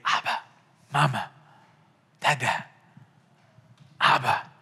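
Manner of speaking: a lightly voiced toddler calling out tenderly; child calling parent. Shown as a demonstration. Pastor imitating a baby talking to its parent, hushed. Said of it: "The way a child talks to their parent".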